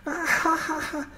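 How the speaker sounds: high hammed-up ah ha ha laugh; mock laughter. Stoned viewer laughing at the Kubrick film, high mock laughter. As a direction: crack up like he's watching a comedy. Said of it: "laughing as if I was watching a comedy".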